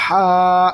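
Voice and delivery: long ہا with strong breathy friction from the throat; correct throat sound. Shown as a demonstration. Teacher gives the correct reading, long throaty ہا. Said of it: "صحیح تلفظ اس کا ہے".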